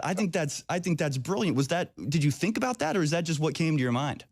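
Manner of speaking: ordinary talk, nothing marked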